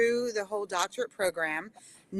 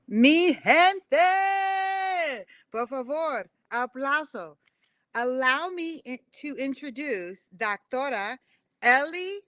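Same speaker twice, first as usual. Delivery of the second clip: lucha libre announcer; 4 semitones higher, slower than usual. Acted. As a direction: drag out the guest's name like a wrestling announcer. Host announces guest like ring announcer.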